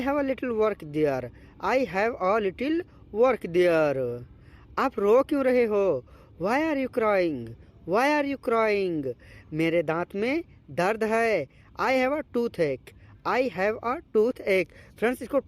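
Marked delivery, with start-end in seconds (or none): none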